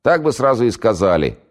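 high, loud grumble; cranky housewife. Acted. Housewife grumbles to the caller, high and loud.

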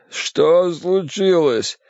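drawn-out, suffering voice; pained moaning. Acted. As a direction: groan the question out weakly. Moaning poisoned man asks what happened.

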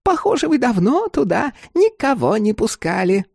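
high, chatty crone voice; old woman prying. Acted. Old woman probes landlord.

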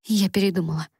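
flat, detached reply; emotionless. A narrator's reading. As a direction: say it with no feeling at all. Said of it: "безэмоционально ответила Элисон". (безэмоционально)

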